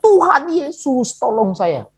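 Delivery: high, stretched-out mock yell, no real volume; retold cry for help. Preacher retelling his cry to Jesus after the fall, mock yelling. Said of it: "Saya teriak".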